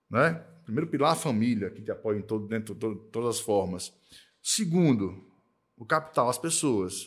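usual speaking voice

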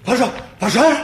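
loud cry of fire; alarm shout. Acted. Крик о пожаре в доме. (тревожный крик)

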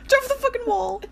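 high, loud frantic shout; excited yelling. Real, not acted. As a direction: yell at the screen in panic. Yelling at game character.

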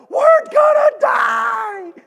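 high, slow, screaming terror; panicked scream. Retold or imitated. Airline passenger to everyone, hammed-up panicked scream. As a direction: howl it like the plane is going down. Passengers hear captain say pray.

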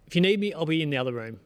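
loud, quick retold parting shot; fed-up dad walking off, re-enacted; exasperated yelling. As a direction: toss it out loudly as you give up. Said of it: "sorry for yelling at you last night".

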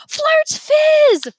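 high, loud squeal of delight; a kid thrilled by soda; excited shout. Acted. Remy seeing the bottles, high excited shout.

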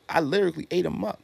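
ordinary delivery

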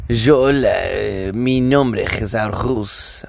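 put-on slow French-accented Spanish; slow mock French accent on Spanish words. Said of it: "French accent with Spanish words".

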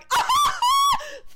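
high, loud laughing shriek; wild laughing scream; Nick Miller shriek. Retold or imitated. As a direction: squeal with laughter at full volume. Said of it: "like Nick Miller going".

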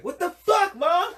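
put-on squeaky high outraged little boy, laughing; mock shocked boy. Boy on the toilet yelling at mom, high and laughing. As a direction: play a kid swearing at his mom in shock. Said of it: "all the boys are like".

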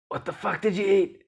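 put-on coach's foreign accent, high and loud; Seb's accent. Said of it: "in his accent".

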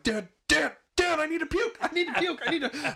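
re-enacted high-pitched panicked yell; urgent calling out. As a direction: plead loudly through a locked door, pitch way up. Banging on locked bathroom door.

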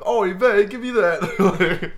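high, loud wailing complaint; exaggerated indignant victim; mock lament. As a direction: wail it in hammed-up dismay. Acted victim with mock Yiddish lament.

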